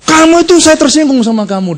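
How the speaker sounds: mock indignant outburst; angry foreigner. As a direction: act out his offence. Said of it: "Bulenya marah".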